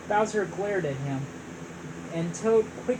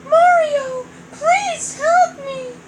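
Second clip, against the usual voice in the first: high, loud, slow plea; princess pleading; 9 dB louder, slower than usual. Acted. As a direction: beg for help, high-pitched.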